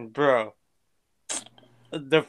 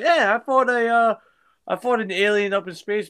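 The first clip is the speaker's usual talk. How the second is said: higher, put-on young voice; a touch of Tom Holland; imitating Tom Holland; 7 semitones higher, 3 dB louder than usual. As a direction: play the eager kid.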